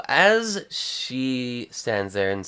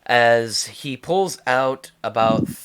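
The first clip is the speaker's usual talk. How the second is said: usual speaking voice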